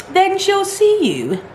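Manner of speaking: high, slow sing-song mockery; mocking. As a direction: drag it out mockingly.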